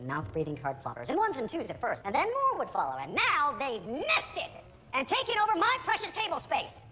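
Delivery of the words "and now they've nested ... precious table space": indignant nerd rant. Acted. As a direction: build to an aggrieved high cry. Ranting about card players invading.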